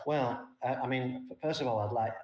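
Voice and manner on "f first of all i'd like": ordinary delivery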